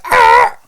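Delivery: short high, loud yell; excited yell. Shown as a demonstration. Co-host showing how she gets too excited on mic, excited yell. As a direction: burst out with an excited "Ah!". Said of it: "and yell really loud".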